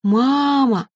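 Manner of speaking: high, long wail for mum; childish wail. A narrator's reading. Baby dragon crying out to his mother, wailing. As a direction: wail it like a youngster in trouble. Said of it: "заголосил Кирюша".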